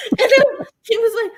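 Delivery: high, laughing through tears; laughing and crying. Real, not acted. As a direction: talk through relieved laughter and sobs.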